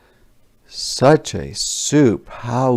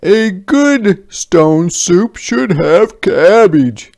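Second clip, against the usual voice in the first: higher, louder, slow knowing soldier; soldiers' voice; 9 semitones higher, 9 dB louder, slower than usual. A narrator's reading. Soldier hinting to villagers for cabbage, higher and louder. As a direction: lay it out slowly like an expert cook. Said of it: "said the soldiers".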